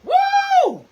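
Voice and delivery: high loud joyful woo; real excited whoop; delighted whoop. Real, not acted. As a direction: whoop at the first sip. Tasting tea, delighted.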